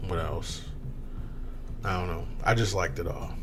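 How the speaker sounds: ordinary talk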